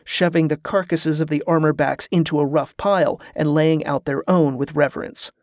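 ordinary delivery, nothing marked

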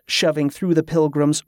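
ordinary delivery, nothing marked

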